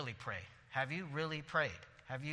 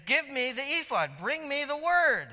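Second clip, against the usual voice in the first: urgent command; 11 semitones higher, 7 dB louder than usual. Acted. David's urgent command voiced.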